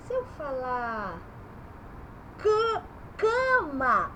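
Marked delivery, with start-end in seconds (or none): 2.4-4.1 "cama" with a long nasal "an" vowel; high, drawn-out nasal "cama"; nasal A sound. Shown as a demonstration. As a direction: stretch "cama" slowly, first vowel through the nose. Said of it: "eu falo an".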